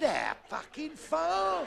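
high, drawn-out yell; mock-astonished long-distance shout; incredulous shout. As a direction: holler it slowly up the mast in disbelief. Captain shouts back, incredulous.

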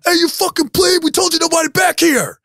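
high-pitched acted-out yell; imitated yell. Frat brother chewing out the freshman in the doorway, yelling. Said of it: "he just yells at him".

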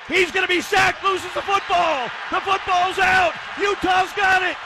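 high, ringing shouts; ecstatic clinching call. Real, not acted. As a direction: proclaim the win to the fans. Calling the clinching fumble recovery.

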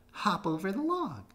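high bouncy bunny voice; an eager little rabbit begging to play; Thumper voice. A narrator's reading. Thumper coaxing Bambi to play, high eager voice. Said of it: "Thumper called".

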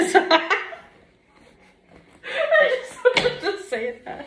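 laughing, slow and high. Real, not acted. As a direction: giggle out the words. Laughing hard.

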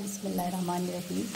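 normal talking voice

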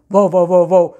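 loud, rapid woofs; happy dog barks. Acted. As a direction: bark excitedly like a small dog. Dog barks happily at owner.

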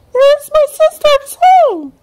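very high, loud and slow; calling out in excitement; high squeaky excited call; eager little Pai Hai. A narrator's reading. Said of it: "cried Nani".

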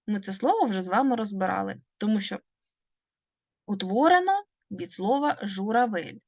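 ordinary delivery

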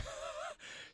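soft, breathy little laugh; a short, quiet chuckle to yourself; amused chuckle. Real, not acted. Amused by skin's skimpy outfit.

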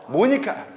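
"모니카" high with a rounded mo; mock-wrong "모니카"; wrong Korean-style vowel. Shown as a demonstration. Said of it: "모니카 아니야".

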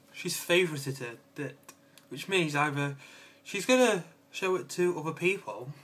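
ordinary talk

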